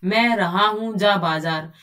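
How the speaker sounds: slow, halting wrong word order; jumbled sentence. Shown as a demonstration. Teacher mimicking a child's jumbled sentence, slow. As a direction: drag out the muddled sentence like a confused child. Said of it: "वो क्या बोलेगा".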